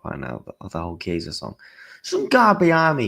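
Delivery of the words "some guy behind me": telling the mates about the guy behind him, excited burst. Real, not acted. Vlogger starting the big anecdote to his viewers, a sudden excited jump. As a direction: pitch jumps up and louder. Jumps up high and loud.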